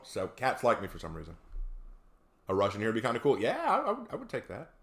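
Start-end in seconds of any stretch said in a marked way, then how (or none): none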